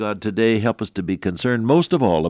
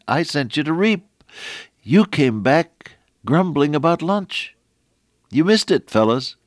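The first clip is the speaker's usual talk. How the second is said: mild rebuke; 8 semitones higher, 4 dB louder than usual. Retold or imitated. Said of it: "Jesus said".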